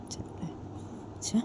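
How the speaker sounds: whispered timid reply. A narrator's reading. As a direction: breathe the words out. Ritu whispers timid answer. (डरी हुई फुसफुसाहट में)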